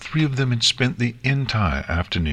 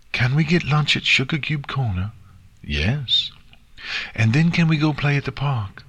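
eager filly, high and bright; excited; 8 semitones higher than usual. Acted. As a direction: rattle off wishes eagerly. Nix excitedly asking.

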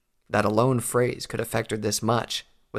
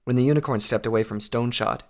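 plain reading voice

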